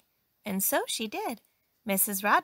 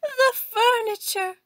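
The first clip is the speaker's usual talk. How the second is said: high, loud, drawn-out shock; horrified cry; 10 dB louder, slower than usual. A narrator's reading. Employer sees the furniture, cries out in high horror. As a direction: cry out slowly, aghast. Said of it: "she cried".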